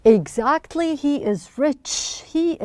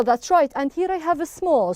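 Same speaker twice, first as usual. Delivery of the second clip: ordinary delivery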